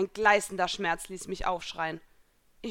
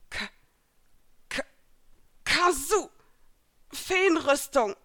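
stuttered start bursting into high, loud cry; barely getting it out; 7 semitones higher, 6 dB louder, slower than usual. A narrator's reading. Exhausted Elsa summoning fairy armour, forced-out cry. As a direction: struggle for the word, then shout it slowly. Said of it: "brachte sie noch heraus".

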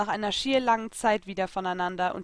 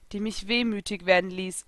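ordinary delivery, nothing marked

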